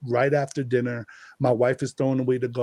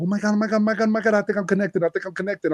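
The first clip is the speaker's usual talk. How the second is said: much higher, breathless retold excitement; gasping to his wife, much higher; excited; 7 semitones higher, much faster than usual. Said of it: "I'm so excited".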